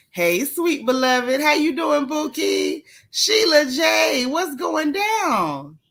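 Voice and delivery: high, loud, slow call to a friend; excited call-out. Real, not acted. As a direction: stretch it out, hailing her happily. Calling out greeting to viewer.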